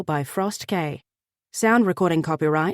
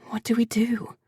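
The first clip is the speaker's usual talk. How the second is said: breathless whispered plea; hushed desperate question; 4 semitones higher than usual. A narrator's reading. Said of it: "she whispered, feeling desperate".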